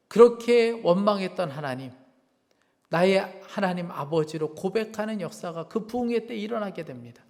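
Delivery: normal talking voice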